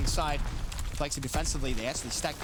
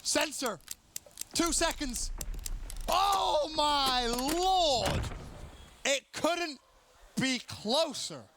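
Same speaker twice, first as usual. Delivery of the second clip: shrieking jackpot winner; 11 semitones higher, much slower than usual. Real, not acted. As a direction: shriek with disbelief at the finish. Screaming over clutch ending.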